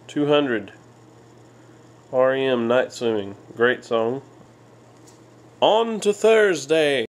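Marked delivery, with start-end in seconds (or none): 5.6-7.0 upbeat announcement. Real, not acted. Announcing next day.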